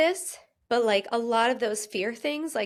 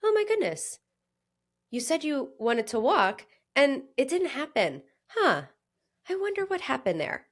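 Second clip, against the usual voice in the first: loving curiosity; 4 semitones higher than usual. Retold or imitated. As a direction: gently wonder instead of scolding. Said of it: "from a place of love sounds more like this".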